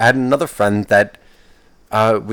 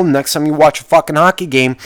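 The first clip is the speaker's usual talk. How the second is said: usual speaking voice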